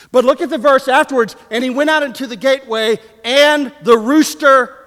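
high, loud scripture reading; full-force preaching. Acted. Preacher cueing the congregation on the rooster verse, full-force preaching.

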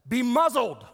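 loud, high sharp command; Jesus muzzling the demon, loud; commanding rebuke. Acted. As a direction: snap the command to shut it up.

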